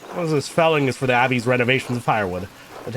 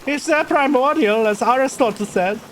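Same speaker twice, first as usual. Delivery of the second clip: put-on higher-pitched German-accented character; 11 semitones higher, slower than usual. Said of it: "went for the German accent with this guy".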